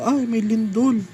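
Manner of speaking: high, rising cry of alarm; startled exclamation. Retold or imitated. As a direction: re-enact suddenly noticing an earthquake, pitch jumping up. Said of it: "nagulat lang talaga ako".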